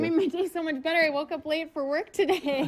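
ordinary talk, nothing marked

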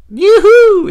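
high, loud whoop breaking upward; gleeful, squeaky cracked cheer; voice cracking. Real, not acted. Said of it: "my voice cracked".

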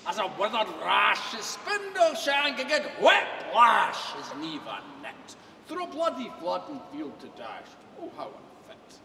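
booming dramatic Scottish recitation; poem recitation. Acted. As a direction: declaim the poem with full theatrical force. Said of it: "the address to a haggis".

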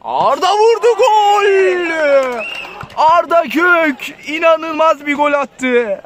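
high, loud goal roar; shouted goal call. Real, not acted. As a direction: belt out the goal. (bağırarak gol anlatımı)

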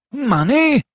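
scornful cry. A narrator's reading. Fetz refusing the doctor's offer, outraged cry. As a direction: spit the word back in disbelief. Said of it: "cried Fetz".

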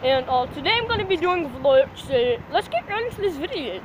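lightly put-on Australian YouTuber accent; a hint of a goofy Australian YouTuber accent; mock Australian accent. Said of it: "Let me try my Australian voice".

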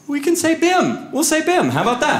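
high-pitched acted dad suggestion; dad's bright idea. Dad offering his son a substitute word, high-pitched.

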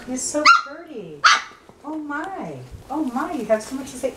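high, hushed coo, quickening on "so much to say"; puppy cooing. Real, not acted. Cooing at Serenity.

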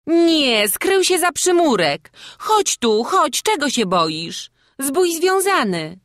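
put-on high, loud calling to a child. A narrator's reading. Townsperson coaxes hiding Wawrzuś to come, calling out.

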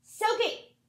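loud, high shout of the cat's name; yelling at the cat. Real, not acted. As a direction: yell across the room to stop her.

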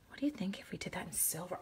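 conspiratorial whisper. Real, not acted. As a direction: whisper the idea like a secret. Sudden aside to viewers.

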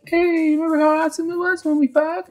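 squeaky falsetto female impression; mock drunk woman. Recapper as the hammered woman reminiscing with her ex, tipsy falsetto. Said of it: "get fucking shit-faced hammered".

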